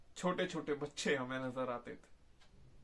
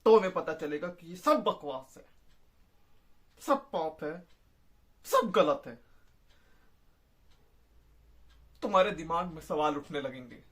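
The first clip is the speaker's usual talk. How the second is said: raised voice, punching key words; agitated disillusionment; 4 dB louder than usual. Acted. Soldier denouncing war to his listener, agitated and disillusioned. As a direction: build into a loud, tormented tirade.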